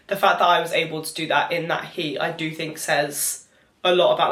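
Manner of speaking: ordinary talk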